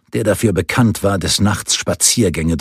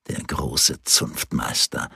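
mocking whisper; 6 semitones lower, 7 dB quieter, slower than usual. A narrator's reading. Imagined scornful mockery of Lück.

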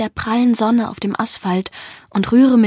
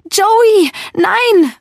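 high, loud, desperate shout; calling out; 10 semitones higher, 6 dB louder than usual. A narrator's reading. Seeing Joey's face in the puddle, calling out. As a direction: call out in alarm, high and loud. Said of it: "rufe ich".

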